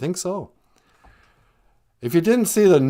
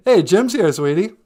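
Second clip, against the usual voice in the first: normal talking voice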